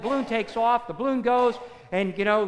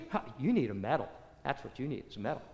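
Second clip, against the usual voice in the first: lowered wizard voice; 9 semitones lower, 8 dB quieter, faster than usual. Acted. Wizard offers lion a medal.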